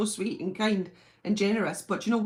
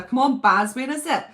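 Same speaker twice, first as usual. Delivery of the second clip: eager call; 4 semitones higher, 8 dB louder than usual. Real, not acted. Impatient for the trailer.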